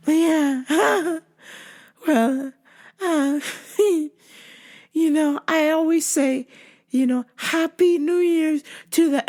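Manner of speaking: high put-on voice with little titters; creepy stalker. Stalker giving her stock greeting, creepy high sweetness. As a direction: giggle and coo awkwardly up high.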